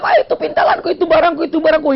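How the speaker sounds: high-pitched mock yelling; crying out. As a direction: imitate a woman wailing after stolen goods. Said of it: "Si perempuan itu teriak-teriak".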